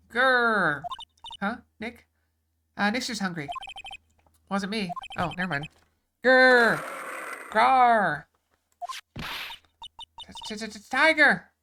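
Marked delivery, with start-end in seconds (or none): 0.1-0.8 mock tiger growl. Tiger growl heard in scene. 6.2-8.2 loud, hammed-up tiger growl rolling the r; cartoon big-cat snarl, loud; mock tiger growl. Tiger roars, player voices it. 10.5-11.5 stuttering fright. Acted. Frightened by approaching tiger.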